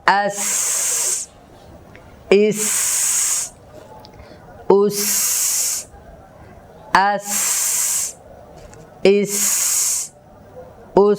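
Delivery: brief vowel, long hissed s closing আস, ইস, উস; breath after consonant. Shown as a demonstration. Teacher showing hams after sakin, hissed ending on আস, ইস, উস. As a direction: close each of আস, ইস, উস with a sustained sharp s. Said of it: "হামসের আওয়াজটা সাকিন উচ্চারণ করার পরে হবে".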